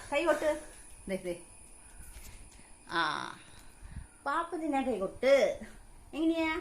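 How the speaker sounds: high sing-song coaxing of a little one; baby talk. Acted. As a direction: coax the baby, high and bright. Mother coaxing her baby to clap. (കുഞ്ഞിനോട് കൊഞ്ചി സംസാരിക്കുന്നു)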